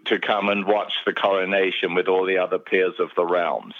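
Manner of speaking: ordinary talk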